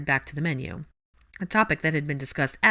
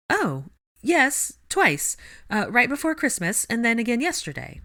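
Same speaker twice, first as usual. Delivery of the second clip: higher, choppy flustered bursts; staccato reply; 8 semitones higher than usual. A narrator's reading. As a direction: clip each phrase out nervously. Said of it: "came the staccato reply".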